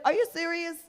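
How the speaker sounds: high mock disbelief; incredulous. Reacting to the tennis headline, in high mock disbelief. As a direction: pitch it up in disbelief. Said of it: "You're like".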